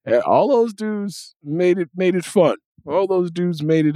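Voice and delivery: usual speaking voice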